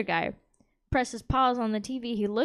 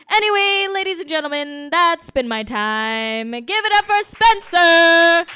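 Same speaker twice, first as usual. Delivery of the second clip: announcer send-off; 5 semitones higher, 10 dB louder than usual. Real, not acted. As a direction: drag it out and boom it to the crowd. Comic closing set, introducing host.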